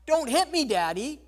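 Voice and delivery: put-on high, loud, pleading cry of a scared child; child's frightened cry, loud and high; frightened child's plea. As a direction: beg like a child about to be struck. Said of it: "spirit in us that cries out not".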